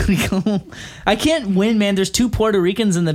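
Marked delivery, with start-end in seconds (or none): none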